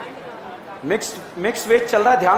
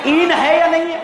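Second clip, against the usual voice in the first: excited quizzing; 7 semitones higher than usual. Real, not acted. Teacher quizzing class loudly.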